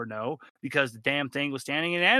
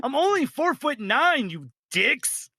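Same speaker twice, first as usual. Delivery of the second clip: higher, louder indignant little killer; offended dwarf killer; 11 semitones higher, 4 dB louder than usual. Acted. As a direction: huff like a touchy short guy.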